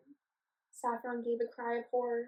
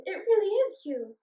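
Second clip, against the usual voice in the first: excited; 8 semitones higher, 8 dB louder than usual. Acted. Sisters reunited.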